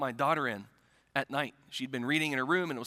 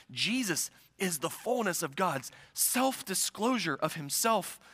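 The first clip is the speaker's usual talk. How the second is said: solemn preaching; 2 semitones lower, slower than usual. Real, not acted. Preacher unfolding John's prologue to his church, solemnly. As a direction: let each phrase settle on the congregation. Weighty, slow declaration in sermon.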